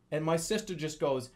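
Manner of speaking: ordinary talk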